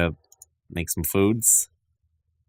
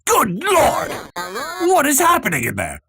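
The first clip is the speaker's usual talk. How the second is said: very high, shocked outburst; acted alarm; 13 semitones higher than usual. Superintendent seeing into the burning kitchen, acted alarm. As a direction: exclaim in hammed-up horror, pitched way up.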